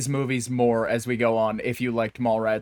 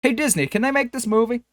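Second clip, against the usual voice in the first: lightly voiced hesitant middleman, pitched high; a hint of a flustered go-between; unsure middleman; 9 semitones higher than usual. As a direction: pass the question up nervously, voice lifted high. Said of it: "And Miramax goes".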